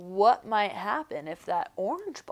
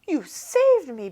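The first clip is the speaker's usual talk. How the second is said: crying out; 6 semitones higher, 4 dB louder than usual. A narrator's reading. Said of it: "Big Bark cried".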